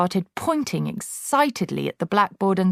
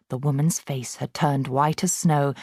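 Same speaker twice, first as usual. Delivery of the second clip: ordinary delivery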